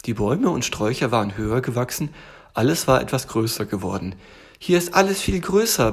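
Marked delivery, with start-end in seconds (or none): 4.6-5.9 high, amazed little tiger; little tiger calling out. Acted. Tiger calls to bear about bigger house, amazed. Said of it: "rief der kleine Tiger".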